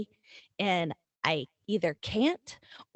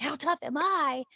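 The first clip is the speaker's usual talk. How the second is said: mock tough guy quote; 8 semitones higher than usual. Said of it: "my daughter is like".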